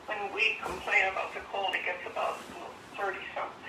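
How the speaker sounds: ordinary delivery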